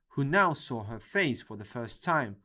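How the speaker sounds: plain reading voice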